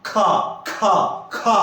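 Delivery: खा repeated with a strong aspirated breath from the throat; whispered खा खा खा; breathy खा demo. Shown as a demonstration. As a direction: push air on each खा, feel the throat. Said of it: "बोल के देखिएगा कि खा".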